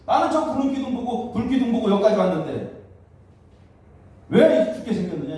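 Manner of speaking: high, exasperated mock grumbling; resentful complaint. Israelites who followed the pillars now facing death, resentful. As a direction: act out a bewildered, aggrieved protest. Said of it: "원망하는 거예요".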